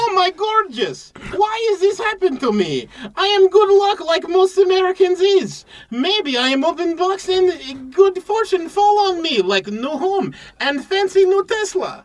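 excited buyer dreaming of a Tesla; mock excited. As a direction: gush over the box like a lucky winner. Excited buyer in broken English.

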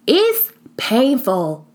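real shake in the voice, very slow; shaky voice. Real, not acted. Admitting to listeners that redefining hurts, voice shaking. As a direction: let the words tremble out slowly. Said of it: "you hear that shake in my voice".

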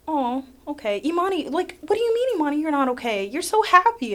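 high, loud incredulous voice; mocking disbelief. Mimicking friends doubting her, high and loud. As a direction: squeak it out like a baffled friend. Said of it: "they'd be like".

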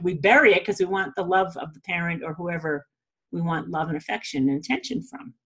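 ordinary talk

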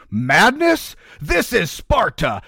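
hammed-up movie shout; Sparta shout. Sparta movie quote parody.

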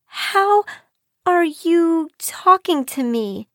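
high, slow, halting question; high-pitched bewildered girl, words drawn out; stammered disbelief. A narrator's reading. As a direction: falter after "How" in astonishment. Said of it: "she stammered".

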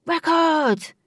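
high loud shout. A narrator's reading. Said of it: "The man shouted".

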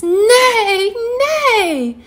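high, drawn-out pretend neigh; whinny the word in a high kitten voice; little cat playing horse; kitty neighing.

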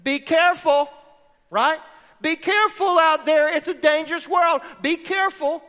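a touch of a worried mom calling after you; mom's warning. Retold or imitated. Mom sending kid off to school, mild mock warning. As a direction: mimic mom's protective be careful, lightly. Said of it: "She'd say".

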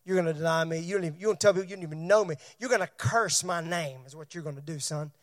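quiet, knowing warning to Peter; calm Jesus. Acted. Jesus quietly telling Peter he will deny him. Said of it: "And Jesus said".

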